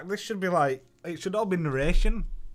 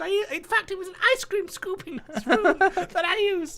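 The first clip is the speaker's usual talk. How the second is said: put-on much higher, breathless oversharing girl voice; mock Twitter rambler; 8 dB louder than usual. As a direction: parody a tweet about breakfast. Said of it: "It's like people with Twitter when they're having".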